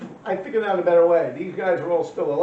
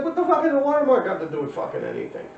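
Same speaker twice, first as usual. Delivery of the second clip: exasperated outburst; 9 semitones higher, faster than usual. Retold or imitated. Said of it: "So I'm like".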